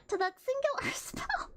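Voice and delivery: can't keep a straight face while reading; laughing mid-read. Real, not acted. Reading to chat, cracks up laughing mid-line.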